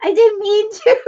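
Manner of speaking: high-pitched plea dissolving into laughter; laughing protest. Real, not acted. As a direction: protest innocence while cracking up. Helpless laughing fit after slip.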